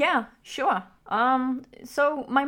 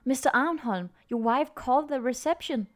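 story narration. A narrator's reading. Reads the story over music.

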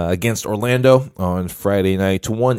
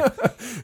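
high laugh; 14 semitones higher, 7 dB quieter than usual. Real, not acted.

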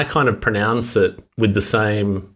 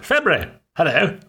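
higher mock-Australian self-impression; exaggerated Australian accent; 8 semitones higher than usual. Shown as a demonstration. Panellist mocks how he probably sounds, exaggerated Australian accent. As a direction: send up your own Australian accent, pitched up. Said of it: "I probably sound like".

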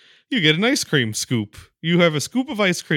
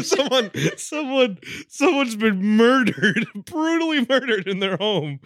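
laughing mock announcement; 7 semitones higher than usual. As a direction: announce it gleefully while cracking up. Acts gleeful friend, cracking up.

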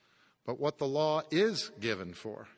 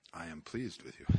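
much softer, lower, near-hushed tenderness; hushed, lower tender voice of Christ; 9 semitones lower, 14 dB quieter than usual. Acted. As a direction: let it fall to a soft, low hush. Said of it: "He sounds like this".

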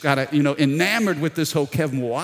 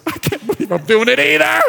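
higher, louder rueful disbelief; mock disbelief; 11 semitones higher, 7 dB louder than usual. Pastor answering the stranger while handing over the jersey, loud mock disbelief. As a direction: groan it out, half laughing at yourself.

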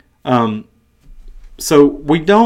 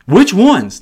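higher, louder burst of eager asking; excited questioner; 7 semitones higher, 7 dB louder than usual. Retold or imitated. Young man eagerly questioning Jesus, excited. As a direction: ask it with pitched-up, eager excitement. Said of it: "You can just hear the excitement in his voice".

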